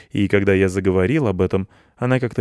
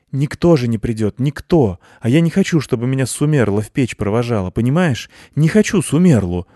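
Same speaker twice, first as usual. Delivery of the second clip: fierce and heated; 4 semitones higher, 6 dB louder than usual. A narrator's reading. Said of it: "зашептала, зло и горячо".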